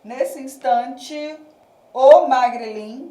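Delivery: "Nesse instante, o magrilim" slowly, with a loud, stretched "o"; slow scansion, leaning hard on "o"; highlighting the article. Shown as a demonstration. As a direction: punch the "o" before "magrilim". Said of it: "O "o", que seria o artigo separado".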